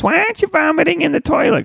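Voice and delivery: high mocking imitation of his girlfriend. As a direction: squeak it out like a nagging partner. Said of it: "Tasha walks by and goes".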